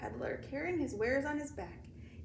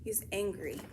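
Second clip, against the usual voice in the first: usual speaking voice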